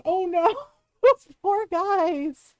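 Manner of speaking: slow, laugh-cracked "oh no"; laughing pity. Real, not acted. Streamer laughing at the poor construction guys' plight. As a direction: pity breaking into helpless laughter.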